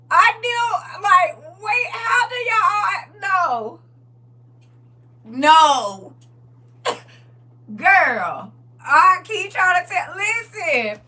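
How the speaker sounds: high squealing laugh through the words; can barely talk for laughing; laughing hard. Real, not acted. Host losing it laughing at the caller's story.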